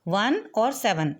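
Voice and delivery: slow, with वन and seven kept apart; slow digit-by-digit reading; separately, slowly. Shown as a demonstration. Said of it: "इसे हमने separately read करना है".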